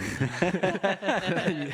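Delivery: burst of laughter. Real, not acted. Laughing at dead children joke.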